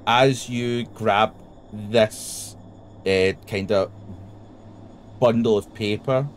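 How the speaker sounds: slow, halting reveal with long pauses; suspenseful game master narration. A narrator's reading. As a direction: draw out the discovery word by word.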